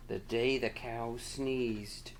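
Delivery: slow, soft title reading; slow, hushed storybook title. Real, not acted. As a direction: read the title out slowly.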